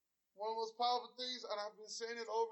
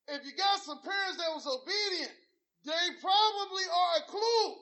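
preaching; 5 semitones higher, 7 dB louder than usual. Acted. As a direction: preach it out to the church. Preaching at full force.

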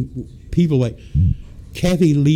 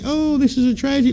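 much higher, fast mock dismay; mock tragic; 11 semitones higher, faster than usual. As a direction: sarcastically fake dismay. Mocking news people.

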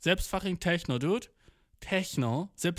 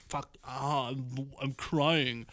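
mock crying; 4 dB quieter, much slower than usual. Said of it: "so fucking am Weinen".

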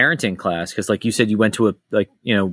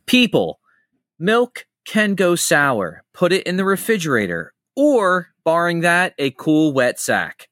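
high, slow, spelled-out instruction; parenting class lecturer; 5 semitones higher, slower than usual. Retold or imitated. Teacher in Simpsons parenting class addressing neglectful parents, slow lecturing voice. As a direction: lecture the obvious with deliberate pauses. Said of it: "that clip from The Simpsons".

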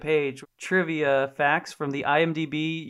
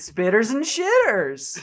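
high, slow, hammed-up villain line; drawn out and pitched up; Malkovich attempt; 7 semitones higher, slower than usual. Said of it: "We all have terrible Malkoviches".

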